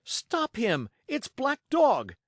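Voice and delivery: high, loud call for help; alarmed cry. A narrator's reading. Boy calls to the tavern after a fugitive, high alarmed shout. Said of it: "I cried".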